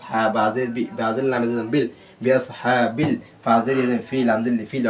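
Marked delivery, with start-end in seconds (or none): none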